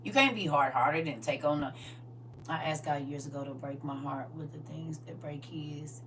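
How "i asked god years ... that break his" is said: breathy whispered confession. Real, not acted. Confessing a risky prayer to the crowd, whispered. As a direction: let it slip out under your breath.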